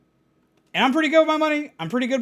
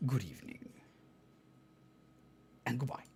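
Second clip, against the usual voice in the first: much lower, softer sinister farewell; ominous sign-off; 9 semitones lower, 16 dB quieter, faster than usual. Pretend. VTuber villain signs off, low sinister voice. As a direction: say it like a menacing villain.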